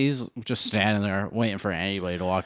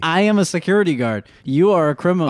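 louder, higher stern declaration; guard asserting; 6 semitones higher, 5 dB louder than usual. Acted. Security guard facing the robber, loud and firm. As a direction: lay down the law.

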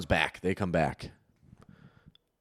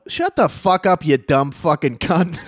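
high, loud, angry shout; acted-out screamed insult; retold instant retort; 5 semitones higher, 6 dB louder, slower than usual. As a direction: yell straight back, drawing out the end.

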